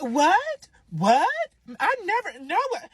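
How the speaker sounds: high, exaggerated disbelief; mock shocked denial. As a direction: imitate someone loudly playing shocked. Mimics rival caught in the affair.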